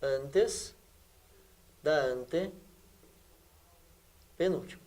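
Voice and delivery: slow, low and soft, with long gaps; quiet dictation pace, as if writing it out; piece by piece. Shown as a demonstration. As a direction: break up ANTES / DA ANTE / PENÚLTIMA, very slowly. Teacher repeats phrase piece by piece.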